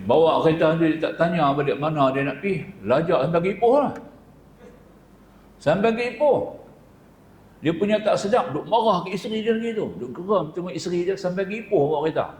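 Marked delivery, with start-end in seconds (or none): 2.9-12.3 much higher, animated storytelling of the runaway drive; dramatic retelling. A narrator's reading. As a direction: build it up like a wild overshoot. (bercerita dengan bersemangat, nada jauh lebih tinggi)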